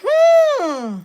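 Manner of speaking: high, drawn-out questioning hmm; musing hmm of growing doubt; doubtful hmm. Retold or imitated. Quoted reaction in church.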